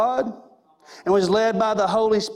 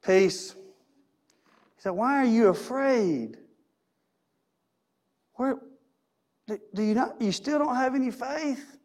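calm Jesus; 4 dB quieter, slower than usual. Acted. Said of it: "And Jesus got up and he's like".

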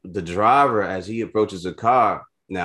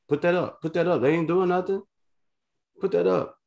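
cop's order; 12 semitones higher than usual. Retold or imitated. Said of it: "he's like".

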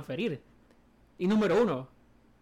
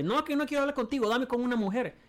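higher-pitched retold refusal; insistent caller; 8 semitones higher, 3 dB louder than usual. Caller refuses the agent, demands a woman, insistent. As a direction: imitate a stubborn customer cutting in.

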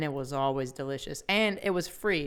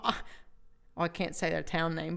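normal talking voice

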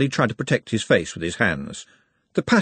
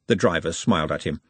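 ordinary delivery, nothing marked

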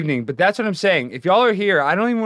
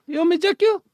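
maid voice; faster than usual. Acted. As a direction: repeat the cheeky maid line.

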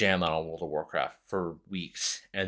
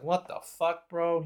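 angry mom; 10 semitones higher, slower than usual. Retold or imitated. Mom scolding him over truancy.

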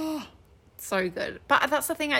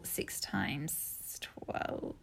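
whispered mental arithmetic; 5 semitones lower, 13 dB quieter, much slower than usual. Real, not acted. Co-host mimicking chef's silent maths in a whisper. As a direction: hush it like counting in your head.

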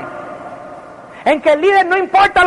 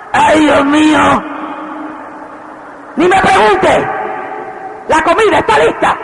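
put-on calling kids to dinner. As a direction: call the family in, fed up. Wife calling family to dinner.

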